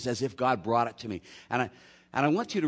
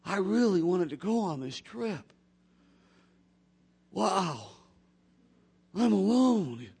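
self-pitying; 7 semitones higher than usual. Retold or imitated. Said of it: "this awesome little pity party".

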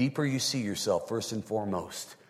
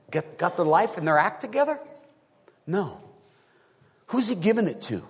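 raised, insistent preacher's voice; impassioned preaching; 9 semitones higher, 5 dB louder than usual. Real, not acted. Preacher asking his congregation who receives God's kindness, insistent preaching. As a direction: press the questions on the congregation, pitched high.

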